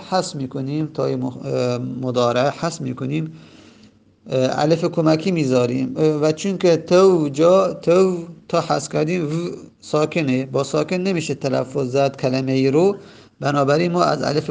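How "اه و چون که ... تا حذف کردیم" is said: ordinary talk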